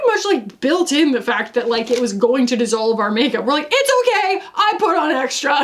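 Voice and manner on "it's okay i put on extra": high, chirped group quote; playful high-voiced mimicry; mock reassurance. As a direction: pitch it way up, hammed-up. Acted quote of heavy-powder wearers.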